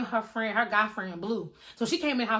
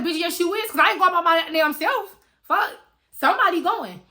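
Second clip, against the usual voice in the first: loud, high-pitched refusal to go alone; insistent arguing; 6 semitones higher, 6 dB louder than usual. Retold or imitated. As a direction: overrule your friend at high volume. Acted quarrel over going outside.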